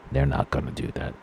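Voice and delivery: real grief, voice dropping to a breath; the words barely voiced, throat closing; choked up. Real, not acted. Parent imagining the families' loss, choked up and barely voiced. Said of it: "I got a little choked up".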